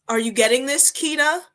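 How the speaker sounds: over-accommodating professor. Retold or imitated. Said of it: "in front of the whole class".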